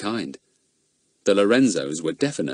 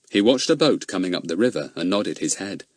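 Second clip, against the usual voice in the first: ordinary delivery, nothing marked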